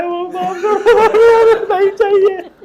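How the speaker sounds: high, loud mock cry of "enough", half laughing; fed-up devotee. Teacher voicing a suffering devotee, laughing mock shout. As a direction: ham up a devotee begging to be spared. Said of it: "वो आखिर में बोले".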